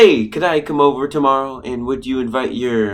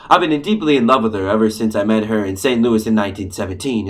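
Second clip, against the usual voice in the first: normal talking voice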